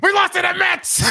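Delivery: very high shouted rant; Mad Dog rant. Retold or imitated. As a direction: scream it like an outraged host. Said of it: "all-time rants".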